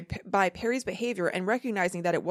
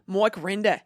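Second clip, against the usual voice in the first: Aussie "Mike Rinder", Mike like "Moik"; slow, broad Australian "Mike Rinder"; Australian accent; 4 dB louder, slower than usual. Shown as a demonstration. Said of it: "that sounded good".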